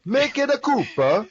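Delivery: put-on very high, loud, slow Italian-accented voice; Italian Miyamoto. Cohost joins in, high squeaky Italian Miyamoto voice. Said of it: "my Miyamoto is Italian".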